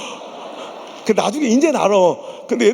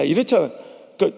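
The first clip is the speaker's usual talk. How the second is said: ordinary talk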